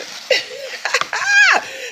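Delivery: giddy laugh up high; real high-pitched cackle of glee. Real, not acted. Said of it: "y'all know I'm crazy".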